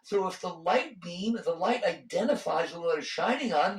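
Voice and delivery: ordinary talk, nothing marked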